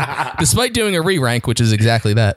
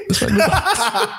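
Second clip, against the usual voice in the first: laughing; slower than usual. Real, not acted. Amused repeat while laughing.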